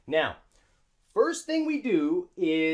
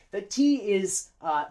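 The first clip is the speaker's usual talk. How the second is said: ordinary delivery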